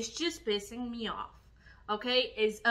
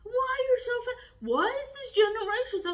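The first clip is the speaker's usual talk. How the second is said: much higher, sneering critic voice, fast; mocking critics; 11 semitones higher, faster than usual. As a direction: mimic the people who call you fat. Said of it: "they're still asking us".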